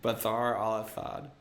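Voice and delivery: Bathar al-Athad with th for every s; lisp. Pretend. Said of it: "someone saying Bashar al-Assad with a fucking lisp".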